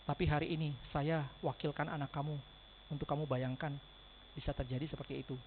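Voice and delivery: heartfelt storytelling. Shown as a demonstration. Agent gently urging a friend to save for his child. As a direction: speak straight to the heart. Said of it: "Nada suara turun dikit, tempo lambat dikit, masukin ke hati".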